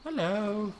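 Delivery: high, drawn-out hello; cooing hello. Pretend. Player coos hello at an approaching dinosaur, high and drawn out.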